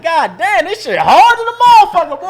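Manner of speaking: loud, high astonished exclaim; hyped outburst at the mansion; amazed hype. Retold or imitated. Arriving at a huge mansion, loud astonished hype.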